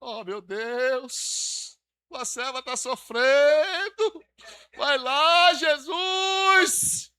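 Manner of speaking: drawn-out slow wail rising to a yell on Jesus; wailing prayer imitation. Preacher mimics sister's wailing prayer to congregation, slow then shouting. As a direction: mimic an over-dramatic praying sister, slow then crying out. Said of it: "a irmã tava orando".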